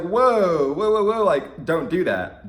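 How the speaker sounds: high, loud whoa whoa whoa; startled warning. Retold or imitated. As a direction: back off a grabby fan, alarmed. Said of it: "she was like".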